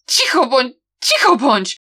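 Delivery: agitated cry, each burst falling at the end; shouted. A narrator's reading. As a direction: shout to silence someone, twice over. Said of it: "krzyknął Xiao Xingczyn".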